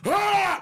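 high yelling grunt on the swing; battle yell. Retold or imitated. Teller reenacts his swing at the guy with a yell. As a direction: let out a high war cry. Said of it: "I came up with".

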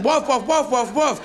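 high, quick, sure-of-itself woofs; insistent barking. Acted. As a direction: bark insistently, high and regular. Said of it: "They're so insistent".

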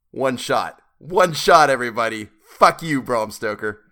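loud cursing at the author; exasperated outburst. Real, not acted. Outburst after fumbling accent read.

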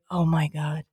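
low, soft exasperated sigh of words; deflated mom resigned to it; weary mom. Retold or imitated. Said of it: "my mom's like".

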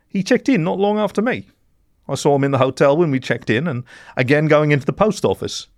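high character voice recalling details; helpful mole in blue. A narrator's reading.

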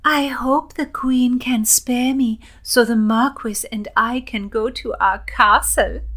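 high, loud gush of delight. A narrator's reading. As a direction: gush it out as an eager young lady. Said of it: "Elin gushed".

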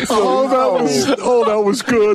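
laughing in delight. Real, not acted. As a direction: crack up while praising. Reacting to impression.

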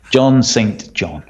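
"John Saint John" slowly, each word separate; Saint said in full. Shown as a demonstration. Host teasing co-host over a listener's name, slow full Saint. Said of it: "Isn't it John St. John?".

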